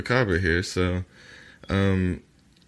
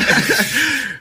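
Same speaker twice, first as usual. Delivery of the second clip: high burst of laughter; 6 dB louder than usual. Real, not acted. Reading like announcer, cracking up.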